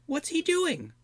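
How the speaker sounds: high squeaky goblin asking; a suspicious little goblin squeak. Acted. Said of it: "Snoke asked".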